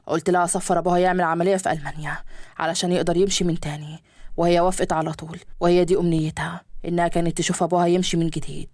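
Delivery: a grown son's low steady voice. Acted. Son explaining his marriage to his father, low male voice. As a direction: speak low and firm as the son.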